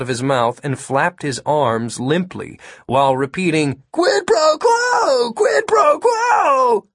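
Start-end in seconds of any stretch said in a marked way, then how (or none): 3.9-6.9 very high, slow, dopey squeal; goofy mocking. A narrator's reading. Kid parroting the agent's Latin phrase, goofy mockery. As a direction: mimic him as a loud buck-toothed fool. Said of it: "Todd crossed his eyes, made his teeth stick out".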